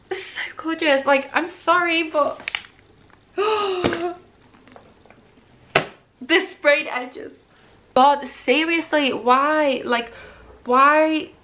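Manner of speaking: high-pitched gushing, overwhelmed by excitement; overexcited, welling up. Real, not acted. Vlogger gushing over a new book's sprayed edges, overexcited. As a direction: gush in a high voice, stopping to gasp. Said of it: "watery eyes every time I get excited".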